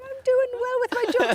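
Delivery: high, put-on voice of a clueless comedian; oblivious comic. Comedian to heckler, a high, proud put-on voice. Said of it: "and I'll be like".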